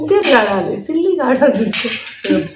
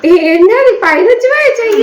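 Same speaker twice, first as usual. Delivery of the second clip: surprised; 10 semitones higher, 4 dB louder than usual. Real, not acted. Catching sister cheating.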